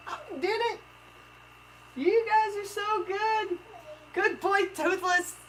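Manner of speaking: very high, soft cooing praise; pet praise. Real, not acted. Owner cooing praise at her clever fish, high and soft. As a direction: coo proudly at the fish.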